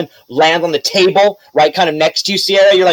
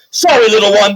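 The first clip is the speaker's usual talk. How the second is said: lightly voiced knight, pitched up; quick acted apology in a raised voice; passing knight; 5 semitones higher, 3 dB louder than usual. Knight leaping over little Belle, lightly acted apology.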